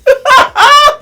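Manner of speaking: high, loud shriek of laughter; squealing laugh. Real, not acted. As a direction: burst out in a squealing cackle. Laughing at her own joke.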